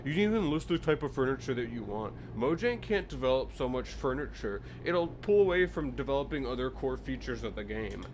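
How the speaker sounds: a lightly voiced condescending commenter; know-it-all commenter. Mimicking one forum guy lecturing about core features, lightly condescending. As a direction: lecture him like a smug forum poster. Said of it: "This one guy's like".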